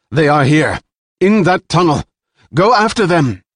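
high, loud orders to her men; commanding shout. A narrator's reading. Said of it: "Jilan shouted".

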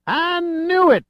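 high, loud excited outburst; bursting out excited. A narrator's reading. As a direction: blurt it out in triumph, pitch shooting up. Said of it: "burst Sam Trinity from the open doorway".

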